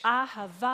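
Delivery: Ahava sung out loud with the final A held; long held ahava. Shown as a demonstration. Leading the congregation, Ahava held out loud and long. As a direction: hold the last A of Ahava as long as breath lasts. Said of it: "hold that out until you're out of breath".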